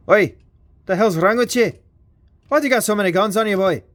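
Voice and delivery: lightly put-on Scottish accent, quick and cheeky; hint of a Scottish brogue, ribbing a friend; mock Scottish. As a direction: voice one toy as a rough Scottish heckler. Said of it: "Why are you Scottish?".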